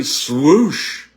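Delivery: loud whooshing swipe noise; swoosh sound. Shown as a demonstration. Coach making a swoosh for the exercise.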